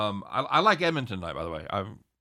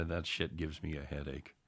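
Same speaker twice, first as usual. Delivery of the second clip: put-on old man voice; 5 semitones lower, 11 dB quieter than usual. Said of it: "I'm gonna do my old man impression".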